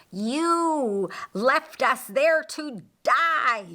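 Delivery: demon attacker shouting at Lucifer in rage. Acted. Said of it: "shouted his attacker".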